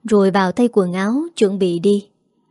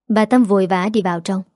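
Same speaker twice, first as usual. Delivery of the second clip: plain reading voice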